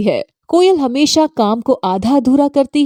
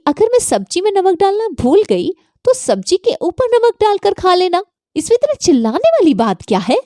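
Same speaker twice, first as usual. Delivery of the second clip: much higher, mocking and dismissive; cheeky retort; 9 semitones higher, faster than usual. Daughter-in-law mocking her scolding mother-in-law, much higher and cheeky. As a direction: brush her off with a high, sassy lilt.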